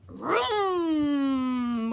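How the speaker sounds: long, drawn-out vroom gliding down; engine vroom. A narrator's reading. Dump truck racing round the curve, drawn-out vroom.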